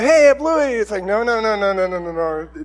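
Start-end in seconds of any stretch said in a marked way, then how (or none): 0.0-0.7 high, loud imitation of delighted children; excited kids. Kids greeting their returned dog, imitated excitement. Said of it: "the kids are excited". 1.0-2.6 rapid-fire string of noes, starting high and loud, falling away; hasty acted refusal. Dad stopping kids' welcome, hurried mock protest.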